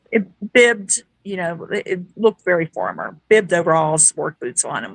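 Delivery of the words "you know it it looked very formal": usual speaking voice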